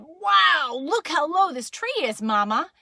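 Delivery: loud, high opening wow; amazed Daddy. Acted. Daddy passes the amazement on to Mama, calling out. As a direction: marvel aloud and call it along. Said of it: "And Daddy said".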